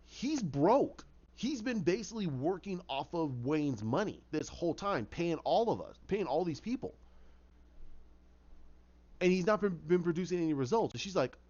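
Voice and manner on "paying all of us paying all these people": plain reading voice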